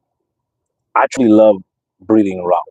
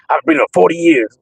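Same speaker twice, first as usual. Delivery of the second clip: mock boastful breeder; 7 semitones higher, faster than usual. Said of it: "people tell me".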